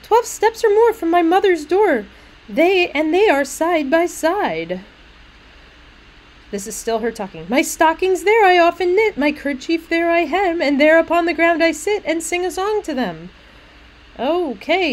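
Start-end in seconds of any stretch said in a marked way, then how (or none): 0.0-4.8 high, loud sing-song recital; cheerful little maid. Acted. Little maid pointing out the graves, cheerful and sing-song. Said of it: "you're certainly cheerful". 7.5-13.3 high, loud sing-song recital; cheerful little maid. Acted. Little maid pointing out the graves, cheerful and sing-song. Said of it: "you're certainly cheerful".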